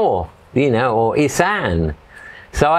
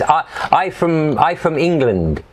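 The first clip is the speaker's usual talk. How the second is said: pidgin English; 6 semitones higher than usual. Retold or imitated. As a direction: stumble out broken pidgin English. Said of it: "Pidgin English was coming out".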